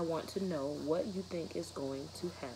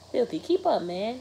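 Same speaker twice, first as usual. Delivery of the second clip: teasing taunt; 8 semitones higher, 9 dB louder than usual. Acted. Brother taunting at free throws.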